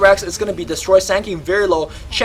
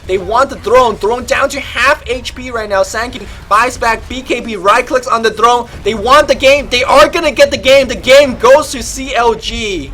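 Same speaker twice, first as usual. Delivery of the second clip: louder and higher as the throne falls; climactic play-by-play; 7 semitones higher, 9 dB louder than usual. Acted. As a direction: build to the winning call. Game ending, throne falling.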